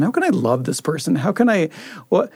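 ordinary talk

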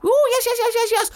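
very high, loud giggling monkey. Acted. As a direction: squeal yes like a giddy monkey. Monkey voiced in story.

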